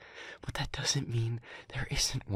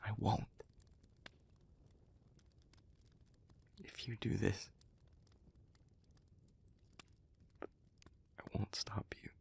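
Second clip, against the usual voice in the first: very soft, near-whispered resignation; subdued pleading; 2 semitones lower, 12 dB quieter than usual. Acted. Speaker promising not to stop the listener, hushed resigned plea. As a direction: yield quietly, with long heavy pauses. Gives in, begs to reconsider.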